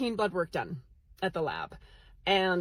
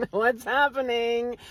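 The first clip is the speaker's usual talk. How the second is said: louder, higher, drawn-out question after a laugh; amused bewilderment; 4 semitones higher, 6 dB louder, slower than usual. Retold or imitated. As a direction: stretch it out in mock disbelief. Repeats thought louder after laughing.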